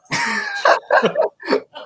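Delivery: long high-pitched laugh; real laughter. Real, not acted. Reacting to the end of the bowling story, laughing. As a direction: crack up at the punchline.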